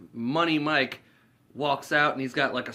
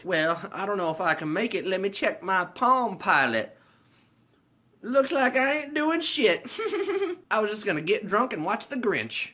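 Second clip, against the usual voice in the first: Money Mike; 9 semitones higher, 3 dB louder than usual. Retold or imitated. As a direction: play a drawling pimp weighing an invitation. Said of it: "they invite Money Mike".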